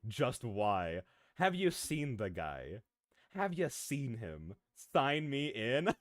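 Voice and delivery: ordinary talk, nothing marked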